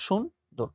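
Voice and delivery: সুন্দর in two separated syllables, shun... dor; syllable-by-syllable pronunciation. Shown as a demonstration. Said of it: "এই যে দেখো".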